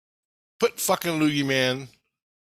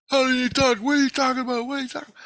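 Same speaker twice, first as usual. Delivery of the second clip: very high, squeaky mock yelling; high falsetto babble of protest; mimicked yelling; 13 semitones higher, 4 dB louder, faster than usual. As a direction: mimic a frantic crowd badgering you. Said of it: "All these Christs are yelling at me".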